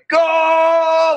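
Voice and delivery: high, drawn-out reenacted yell; overwhelmed shout. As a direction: cry out as if pinned by the rush. Reenacts overwhelming rush of inertia.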